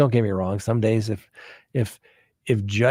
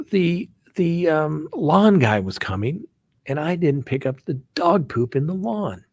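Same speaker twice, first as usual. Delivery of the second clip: ordinary delivery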